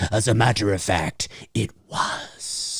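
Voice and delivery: low and hushed, ending in a whisper; lightly voiced grave doctor; Loomis impression. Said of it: "And then Loomis says".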